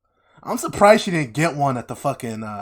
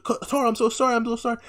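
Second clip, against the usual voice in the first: much higher, pleading sorry; pleading apology; 11 semitones higher, faster than usual. Retold or imitated. Aang pleads with Katara, high and desperate. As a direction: beg forgiveness in a high voice. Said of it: "she's like".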